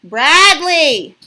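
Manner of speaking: long loud high call of a name; yelling across the room. Real, not acted. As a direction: yell the name to the next room. Mom calling the child.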